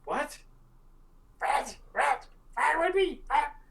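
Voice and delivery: high squeaky kobold chatter; startled kobold. Acted. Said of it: "they're like".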